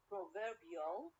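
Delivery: proverbial drawn out with VER stressed; stress on second syllable. Shown as a demonstration. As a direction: slowly shift proverbial's stress onto VER. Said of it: "to the second syllable".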